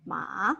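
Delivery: "ma" gliding upward; second tone, rising. Shown as a demonstration. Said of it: "ma is going up".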